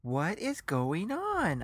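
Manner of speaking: high, slow, incredulous question; bewildered. Acted. Bewildered guest protests.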